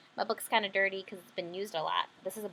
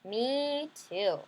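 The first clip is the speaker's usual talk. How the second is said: little sister voice; 2 semitones higher than usual. Acted. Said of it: "she said".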